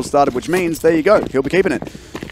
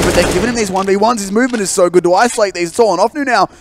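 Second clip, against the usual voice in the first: high-pitched caster urgency; hyped esports commentary; excited play-by-play; 9 semitones higher, 6 dB louder than usual. Real, not acted. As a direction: raise the stakes of the duel.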